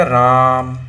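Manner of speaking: राम low, on a long level aa; stretched RAM. Shown as a demonstration. Teacher giving an example of pluta vowel, drawn out. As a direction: hold the vowel of राम like a chant. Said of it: "ये ऐसा उच्चारण होता है इसका".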